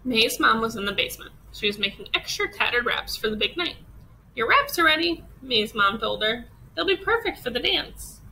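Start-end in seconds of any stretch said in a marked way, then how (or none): 4.4-5.2 cheerful mom voice. Acted. Mom announces wraps ready. 6.8-8.2 cheerful mom voice. Acted. Mom promises perfect fit.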